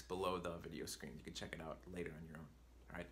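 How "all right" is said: ordinary talk, nothing marked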